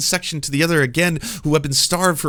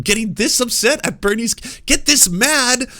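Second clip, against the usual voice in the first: worked-up, raised-voice rant; exasperated rant; 8 semitones higher, 4 dB louder than usual. Real, not acted. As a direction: hammer it out in incredulous outrage. Ranting at others' misplaced anger.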